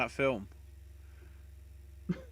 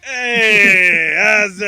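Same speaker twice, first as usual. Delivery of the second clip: loud mock greeting; 7 semitones higher, 18 dB louder, much slower than usual. Mocking entrance, loud high.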